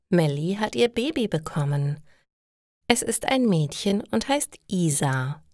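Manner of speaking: ordinary talk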